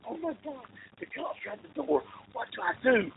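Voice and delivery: soft, rushed, rising panic; panicked whisper. Retold or imitated. Friend hiding from cops at the door, hushed panic.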